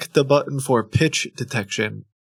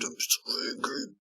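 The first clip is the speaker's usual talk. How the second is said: mock angry shout; 13 semitones higher, 13 dB quieter than usual. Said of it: "make an angry face".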